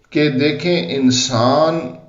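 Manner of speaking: ordinary delivery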